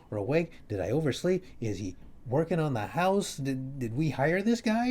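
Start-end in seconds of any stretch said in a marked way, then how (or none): none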